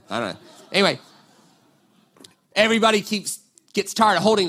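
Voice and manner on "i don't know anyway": ordinary talk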